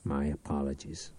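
soft, low grudging mumble; mumbled. A narrator's reading. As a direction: mumble it under your breath. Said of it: "he mumbled".

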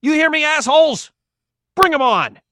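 loud, high taunting bellow; full-force shout. A narrator's reading. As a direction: dare them at the top of your lungs. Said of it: "shouted out as loud as he could".

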